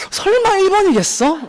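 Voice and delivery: high-pitched flustered student, doubting the answer; flustered disbelief. Retold or imitated. Teacher mimics students doubting the first answer, high flustered disbelief. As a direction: act out a student thrown by an easy answer. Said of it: "좀 당황한다고".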